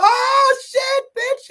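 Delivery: very high, loud yelp; hammed-up high-pitched scream of alarm; mock horrified shout. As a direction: shriek it in exaggerated shock. Acts man's shock at the fins.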